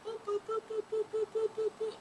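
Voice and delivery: high little boops, soft and quick; playful boop. Retold or imitated. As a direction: pop each boop out like a bubble. Ideas popping up one after another.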